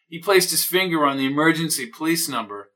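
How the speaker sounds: ordinary delivery, nothing marked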